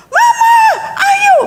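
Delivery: very high-pitched mock child wailing for mama and papa; put-on squealing kid's plea; screaming child. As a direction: imitate a child crying out in a piercingly high voice. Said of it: "terus teriak-teriak".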